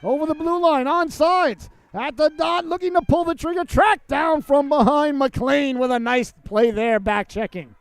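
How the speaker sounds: high, loud, rising hockey call; urgent live rink commentary; excited play-by-play. Real, not acted. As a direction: call the rush as it builds, then settle at the end. Calls a fast rush live.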